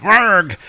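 high, loud lunging yell; short roar as you leap; battle cry. Acted. Thor leaping forward in the cave, battle cry. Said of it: "Thor leapt forward, going".

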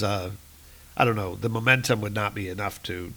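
ordinary delivery

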